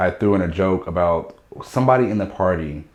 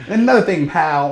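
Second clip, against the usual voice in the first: high, loud, hammed-up gangster line; mock tough guy; 9 semitones higher, 4 dB louder than usual. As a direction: puff up like a cartoon tough.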